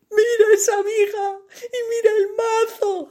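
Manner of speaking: high, sobbing plea; tearful Molly. A narrator's reading. Molly showing her fiancé the mallet, high and tearful. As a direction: cry it out, pointing. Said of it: "las lágrimas se mezclan".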